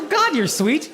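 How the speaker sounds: ordinary talk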